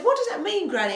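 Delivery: high-pitched quoted question; high, younger-self enquiry; retold question to granny. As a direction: ask granny, pitched right up. Retells own question to granny.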